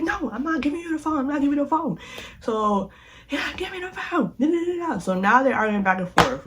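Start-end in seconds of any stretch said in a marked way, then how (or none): 0.0-2.0 defiant refusal. Retold or imitated. Brittany refusing in argument. 3.3-5.0 higher shouted demand; mock shouted demand. Shouting in the argument.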